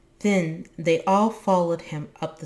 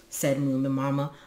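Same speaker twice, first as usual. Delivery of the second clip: plain reading voice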